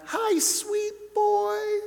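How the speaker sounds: very high, slow loving greeting; mom's high voice. Retold or imitated. Son imitating mom answering the phone, very high and slow. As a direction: stretch it out sky high like mom. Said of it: "She had a voice that could get really high".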